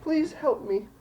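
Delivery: put-on high-pitched small child voice; pleading little girl. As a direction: beg for help as a frightened girl. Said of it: "she's just crying and".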